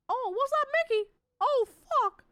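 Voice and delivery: high, loud Kermit the Frog voice; loud high frog puppet voice; Kermit impression. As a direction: squeak it out like the frog. Kermit voice attempt.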